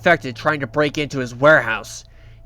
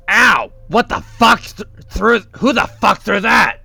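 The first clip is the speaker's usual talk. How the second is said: higher, louder pained outburst, hammed-up; angry outcry; 6 semitones higher, 5 dB louder than usual. Coil hit by a crowbar, hammed-up angry outcry. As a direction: cry out in mock pain and fury. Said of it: "He goes".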